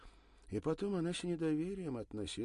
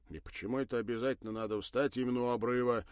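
grumbling; 4 semitones higher, 7 dB louder, faster than usual. Acted. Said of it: "проворчал Жак".

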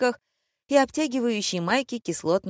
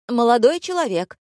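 higher, louder hail; calling out; 6 semitones higher, 6 dB louder than usual. A narrator's reading. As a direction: hail a stranger sharply from across the room. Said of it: "окликнула парня Мария". (громкий оклик)

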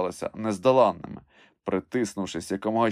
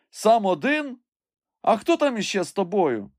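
high, raised questioning voice; puzzled call; 12 semitones higher, 4 dB louder than usual. A narrator's reading. Master asks who else is up there, puzzled high call.

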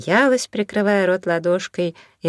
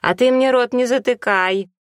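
higher, louder, angry snap back; angry retort; 8 semitones higher, 4 dB louder than usual. Acted. Елена snapping back at Наталья in the quarrel, an angry retort. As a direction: hit back in anger. Quarrel between the two ladies.

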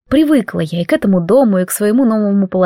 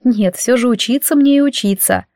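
usual speaking voice

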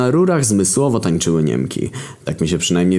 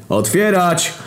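shouted order; 11 semitones higher, slower than usual. Acted. Soldiers banging on the door.

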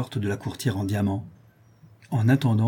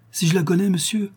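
much higher, emphatic affirmation; fervent exclamation; 9 semitones higher than usual. A narrator's reading. La Louve tells the count of her friend, fervent. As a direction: leap up in pitch with fervour.

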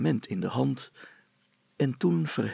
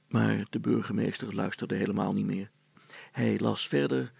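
usual speaking voice

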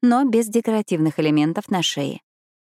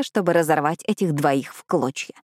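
usual speaking voice

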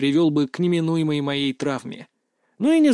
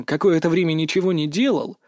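plain reading voice

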